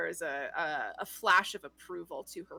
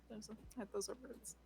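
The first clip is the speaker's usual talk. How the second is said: awed whisper; 2 semitones higher, 18 dB quieter than usual. Real, not acted.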